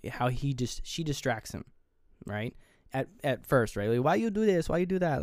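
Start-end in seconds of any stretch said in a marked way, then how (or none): 4.0-5.2 high-pitched mock Spanish accent; poor Spanish accent. He imitates her distracting him, poor Spanish accent. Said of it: "in a poor Spanish accent".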